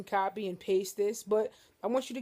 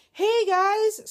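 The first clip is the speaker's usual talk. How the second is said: much higher and louder, bursting with good news; excited greeting; 12 semitones higher, 11 dB louder than usual. Retold or imitated. A clinic nurse calling with good news, bright excited greeting. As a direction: sing it out like the news is great. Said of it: "But today we got the call like".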